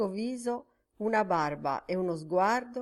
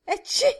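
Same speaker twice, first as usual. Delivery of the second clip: sharp, high, loud sneeze; explosive sneeze; 8 dB louder than usual. A narrator's reading. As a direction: let a big sneeze burst out. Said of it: "sternutì forte".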